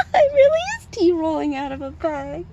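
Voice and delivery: can barely get the joke out for laughing; laughing hard. Real, not acted. Cracking up at own joke.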